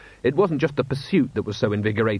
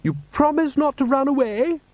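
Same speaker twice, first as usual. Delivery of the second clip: Lady Sybil voice; 3 dB louder than usual. A narrator's reading.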